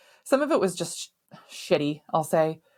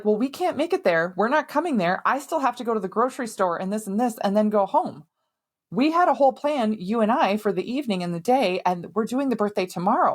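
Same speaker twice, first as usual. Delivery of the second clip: imitated arguing, pitched up and emphatic; raised, frustrated voice listing objections; exasperated protest; 4 semitones higher than usual. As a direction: push back indignantly as if retelling a quarrel. Acted argument with husband over plans.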